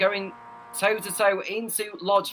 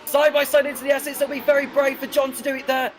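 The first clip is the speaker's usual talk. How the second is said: excited; 6 semitones higher, 3 dB louder than usual. Real, not acted. Overtake attempt in race commentary.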